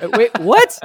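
a record-scratch moment of shock; shocked disbelief. Retold or imitated. Narrator reacting as a colleague starts taking his clothes off, loud stunned double take.

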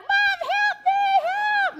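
very high, slow, drawn-out squeal; mock-wail like a pinned child; kids pleading for help. Daughters calling mom while dad pins them, high slow wail. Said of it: "They're like".

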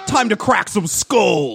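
high, slow, drawn-out battle cry; mock vengeful cop. Cop going after hippie, hammed-up high cry. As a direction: stretch it out like a cartoon cop on the warpath.

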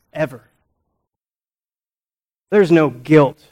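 ordinary talk, nothing marked

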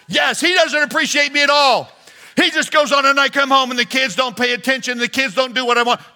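high, loud, rushed wife's rant; complaining wife. Acted. Pastor voicing a wife venting to her husband, high and loud. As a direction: pour out the wife's grievances, high and fast.

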